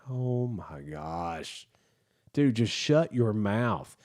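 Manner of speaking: slow, drawn-out weary disbelief; exasperated. Acted. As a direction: drag the words out, fed up. Exasperated reaction to retold man.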